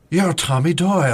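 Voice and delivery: high, loud mock proclamation; Doctor Loomis. Host mimics Loomis recognizing Tommy, mock dramatic. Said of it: "Loomis is like".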